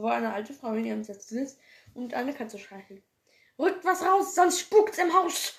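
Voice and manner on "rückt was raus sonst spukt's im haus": hoch und laut gerufener Spruch. Acted. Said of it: "sagt Felix leise". (laut gerufener Halloween-Spruch)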